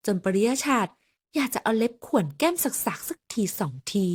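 plain reading voice

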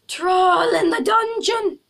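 Quirrell troll panic. Acted. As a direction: wail it like the panicked teacher in the film. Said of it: "Glad it doesn't mean a troll".

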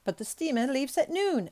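nervous. A narrator's reading. Servant worried about missing the noon steamer, mildly nervous. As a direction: raise the pitch, a touch anxious. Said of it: "observed Passepartout nervously".

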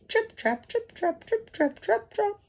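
high, light trip-trap patter; dainty hooves tapping across a bridge; little goat hoof steps. A narrator's reading. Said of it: "feet made that sound on the bridge".

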